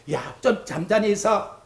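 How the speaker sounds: usual speaking voice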